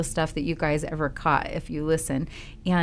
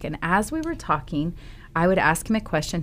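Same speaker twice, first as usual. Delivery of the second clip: ordinary talk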